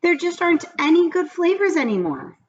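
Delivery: lightly voiced upset little girl; whiny child complaint; plaintive girl. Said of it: "cried Mauricia".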